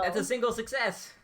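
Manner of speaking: high, loud and thrilled; real burst of triumph; excited announcement. Real, not acted. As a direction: crow over a lucky roll. Player celebrates the successful roll.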